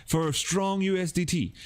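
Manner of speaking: high, over-excited hype voice; hammed-up crypto hype man; mock hype crypto influencer. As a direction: oversell it like a crypto shill channel.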